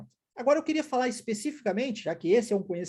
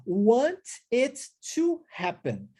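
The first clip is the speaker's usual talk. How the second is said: slow, separated "Want it to happen"; word-by-word model; slower than usual. Shown as a demonstration. Teacher models the phrase for students, slow and separate. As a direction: spell out each word slowly with pauses.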